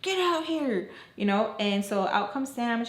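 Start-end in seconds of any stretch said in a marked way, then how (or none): 0.0-0.9 high, carrying mock call; acted-out holler, pitched way up; calling a dog. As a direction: summon her like a dog. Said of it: "like if she was a freaking perra".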